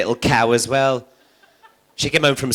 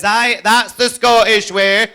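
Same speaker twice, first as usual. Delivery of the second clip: slow, higher, louder mock Scottish with a laugh; Glaswegian punchline; 7 semitones higher, 6 dB louder, slower than usual. A Glaswegian punter tells the comic: slow, grinning Scottish delivery.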